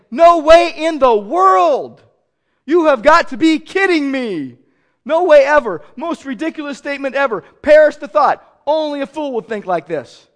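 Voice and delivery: loud, high, outraged exclamations; Paul shouting in outraged disbelief. Retold or imitated. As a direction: shout down a ridiculous idea. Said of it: "a sense of outrage attached to it".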